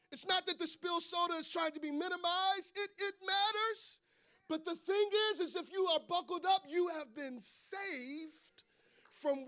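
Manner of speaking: high-pitched, urgent preacher's delivery; impassioned preaching. Real, not acted. Preacher urging listeners to buckle up, impassioned preaching. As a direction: drive the warning home to a congregation, stretching key words.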